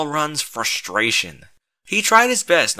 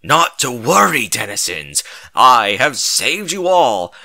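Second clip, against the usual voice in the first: grand heroic voice; 8 dB louder than usual. A narrator's reading. Hero calms the citizens, grand and lofty. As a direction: play the noble savior.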